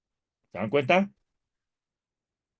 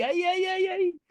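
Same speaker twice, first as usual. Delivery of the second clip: mock dismay; slower than usual. Mock groan at missed goal.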